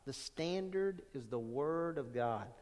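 quiet solemn repeat. Shown as a demonstration.

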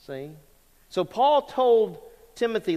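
ordinary talk, nothing marked

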